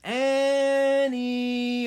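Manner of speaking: high, loud, long drawn call; calling from afar. A narrator's reading. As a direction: call them home from far away. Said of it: "a voice called from the distance".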